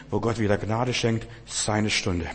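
usual speaking voice

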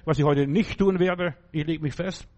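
ordinary talk, nothing marked

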